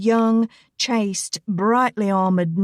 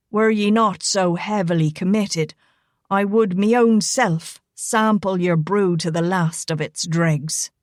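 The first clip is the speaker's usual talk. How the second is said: Irish brogue. Acted. Rigo bowing over Elena's hand, roguish Irish brogue. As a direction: savour the cheeky compliment. Rigo's backhanded compliment.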